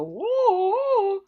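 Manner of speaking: a loud, high, startled whoa; playful exclamation at a busy pattern; mock whoa. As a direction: act dazzled by too much pattern. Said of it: "makes my eyes go".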